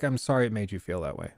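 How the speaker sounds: low, soft, quick mock apology; mock passive-aggressive murmur. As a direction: toss off an insincere sorry, lightly imitated. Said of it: "it's that passive aggressive thing".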